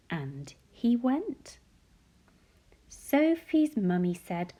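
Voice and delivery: plain reading voice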